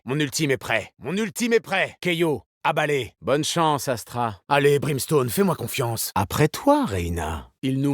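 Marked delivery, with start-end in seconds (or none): 1.0-1.9 higher, eager callout; urgent callout. Acted. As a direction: alert the team, keyed up. (annonce pressante, voix plus aiguë)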